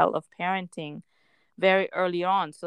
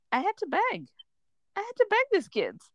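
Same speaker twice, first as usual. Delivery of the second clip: amused disbelief. Real, not acted. Mother laughing at her defeat.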